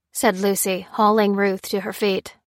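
plain reading voice

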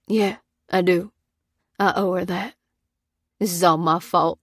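choked-up, guilty confession; halting, grief-choked admission; choking on words. A narrator's reading. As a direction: force words out through grief. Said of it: "choking on his words".

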